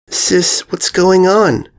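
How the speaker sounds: high, slow frightened whimper; small and scared, needing an answer. A narrator's reading. Filly asking her sister what's wrong, whimpering. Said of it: "she whimpered".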